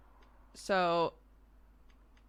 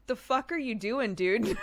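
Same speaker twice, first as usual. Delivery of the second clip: Irish pub guy; 6 semitones higher, 4 dB louder than usual. Retold or imitated. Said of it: "some dude at a pub was like".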